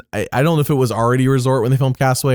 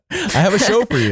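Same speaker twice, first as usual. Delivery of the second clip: higher, showman's teaser; mock announcer pitch; 7 semitones higher than usual. Podcaster hamming up a pitch to their child, higher showman voice. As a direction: sell it like a kids' show host. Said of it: "Have I got a show for you?".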